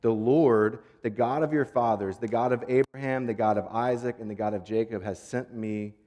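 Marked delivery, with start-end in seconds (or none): none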